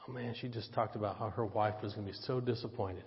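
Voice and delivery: low, hushed thinking to himself; softly voiced private realization; quiet inner thought. Pastor to himself after the lady's call, quiet inner thought.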